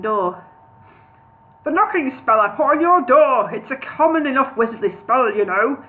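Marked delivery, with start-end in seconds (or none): 1.6-5.8 louder, higher bellowing wizard; bellowed. A narrator's reading. Wizard explains his knocking spell to Bingo, bellowing. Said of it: "he bellowed".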